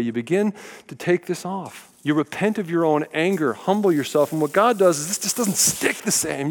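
ordinary talk, nothing marked

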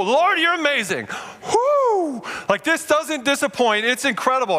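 shouting praise at the canyon rim; awestruck praise. Pretend. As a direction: burst out in praise at the view. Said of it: "all I wanted to do was go".

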